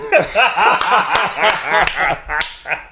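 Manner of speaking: big burst of laughter; laughing at how the friendly played out; hearty laugh. Real, not acted. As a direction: laugh loud and long.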